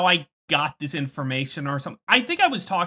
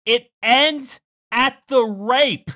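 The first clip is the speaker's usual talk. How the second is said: loud, slow, drawn-out complaint; exasperated outrage; 5 semitones higher, 7 dB louder, much slower than usual. Real, not acted. Viewer fuming at where the anime ends, exasperated outrage. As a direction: stretch each word in raised disbelief.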